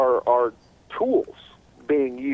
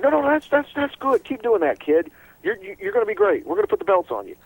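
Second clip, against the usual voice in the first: mock cheerleader praise; 10 semitones higher than usual. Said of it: "the people who are saying".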